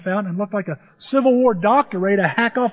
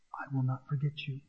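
very soft, lower-pitched promise; hushed reassurance; 5 semitones lower, 13 dB quieter than usual. Acted. God answers Zion's fear of being forgotten, hushed reassurance. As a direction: drop right down to a tender hush.

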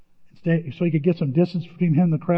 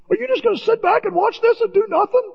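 exasperated complaint. Retold or imitated. Psalmist complaining to God.

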